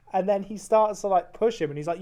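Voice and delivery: ordinary talk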